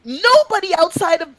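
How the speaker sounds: high, slowed-down exasperation; incredulous protest. Retold or imitated. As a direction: stretch it out high in disbelief. Protesting obscure quiz accent.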